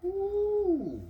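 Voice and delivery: high excited woo; excited whoop. Real, not acted. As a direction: let out a happy whoop. Excited cheer on camera.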